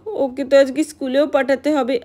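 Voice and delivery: usual speaking voice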